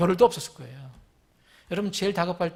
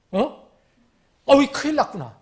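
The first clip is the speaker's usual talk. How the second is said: higher, louder mock alarm; alarmed realization; 6 semitones higher, 4 dB louder than usual. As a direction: act out sudden dread at being caught. Acting the king's alarm. (큰일 났다 싶어 놀란 연기)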